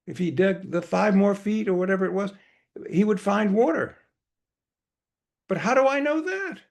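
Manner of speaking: high-pitched, anxious master's protest; Baba. Acted. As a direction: fret aloud in an acted-out worry.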